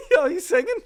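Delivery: high-pitched mock incredulity; laughing bafflement. Fan reacting to singsong accent, laughing disbelief. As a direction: squeal it through laughter, baffled.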